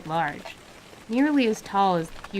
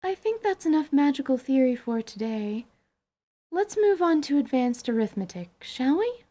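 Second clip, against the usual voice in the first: teacher's brisk voice; 6 semitones higher than usual. Acted. Teacher voice for Anise.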